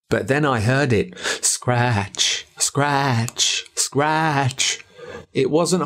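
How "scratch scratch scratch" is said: slow, drawn-out spooky scratch words; eerie scratching. A narrator's reading. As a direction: stretch each scratch out like a creepy noise in the dark. Eerie night scratching in story.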